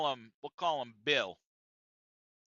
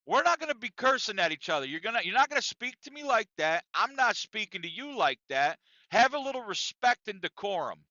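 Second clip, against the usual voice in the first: raised-pitch, firm reprimand from a boss; retold stern telling-off, higher and insistent; Chuck; 7 semitones higher, 3 dB louder than usual. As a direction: act out a boss calling for order. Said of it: "So Chuck finally tells Bill".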